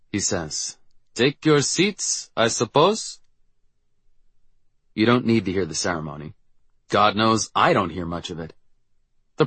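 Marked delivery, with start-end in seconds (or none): 1.1-3.2 put-on slow, bored French Canadian accent. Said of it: "Frenchly Canadian voice of our principal".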